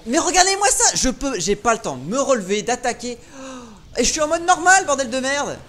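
loud, high exasperated ranting; raging gamer. Real, not acted. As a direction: rage at the unfair game. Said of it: "Désolé, je rage un peu".

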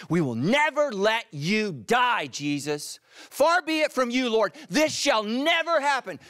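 loud, high, impassioned protest; forceful rebuke. Acted. Peter rebukes Jesus to keep him safe, loud forceful protest. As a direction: forbid it fiercely, pitch way up. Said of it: "so he rebuked Jesus".

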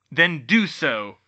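loud, slow command; roared. A narrator's reading. Bane cuts Hermione off, roaring. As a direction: thunder it, each word heavy. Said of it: "Bane roared".

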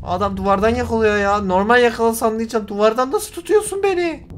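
high protest climbing into a shout; furious yelling. Real, not acted. Gamer yelling at the character that grabbed him, furious. Furious at being caught again.

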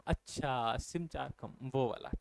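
low, soft acted realisation; elderly person understanding. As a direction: softly echo it as an old person catching on. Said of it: "जो बूढ़े लोग होते हैं ना".

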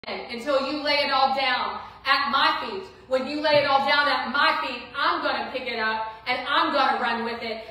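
lightly voiced Lord, loud and pressing; preaching as the Lord. Preacher voicing the Lord to the congregation, loud and urgent. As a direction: speak as God calling his child, full force.

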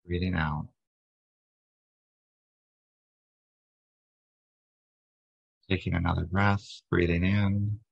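calm, steady body-scan narration, little pitch movement; guided meditation. Real, not acted. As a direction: guide listeners gently into relaxation, unhurried. Leads a guided breathing meditation.

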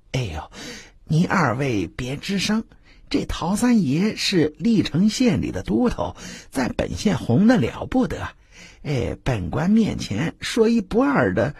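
fretful hostess pleading with guests; nervous hushed warning. Acted. Zhang Dajiao hushes the guests.

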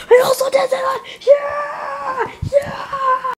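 high sobbing yells; crying shout. Acted. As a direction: shout through happy tears.

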